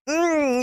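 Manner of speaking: savouring mm. A narrator's reading. Savouring the tasty omelet.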